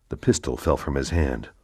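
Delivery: usual speaking voice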